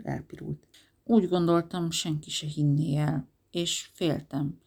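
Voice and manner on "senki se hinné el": ordinary delivery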